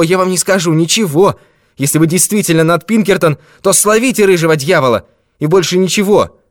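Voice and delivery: put-on high, loud agitated old man; shouting. A narrator's reading. Old man refusing to give name and address, shouting. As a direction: cry out in frantic refusal. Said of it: "Старик так и закричал".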